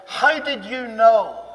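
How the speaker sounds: slow, loud, stunned question; stunned disbelief. Retold or imitated. Shaken Russian man asking how he knew, slow and loud. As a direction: ask it as if shaken to the core.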